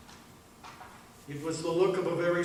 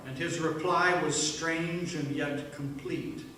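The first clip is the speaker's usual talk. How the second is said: normal talking voice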